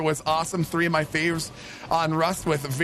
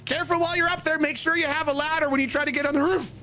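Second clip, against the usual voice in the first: much higher goofy character voice; put-on silly voice; 11 semitones higher than usual. Streamer acting a roof mishap for chat, squeaky goofy voice. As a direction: ham it up, a squeaky cartoon voice. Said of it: "put on the voice for it".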